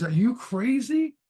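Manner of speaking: high, loud and drawn out; can't believe what he just saw; hammed-up shocked yell; shocked disbelief. Man shocked someone jumped in.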